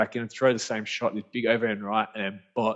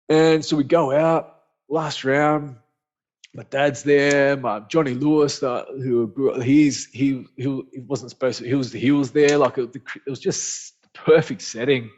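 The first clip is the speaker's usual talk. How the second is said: excited; 4 semitones higher, 8 dB louder than usual. Real, not acted. Reliving the last round.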